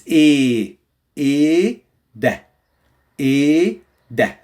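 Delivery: "إيه ده" demonstrated with a long clean vowel and silent H; H left silent. Shown as a demonstration. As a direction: stretch the vowel of "إيه", then add "ده" without any h. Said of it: "So we don't say".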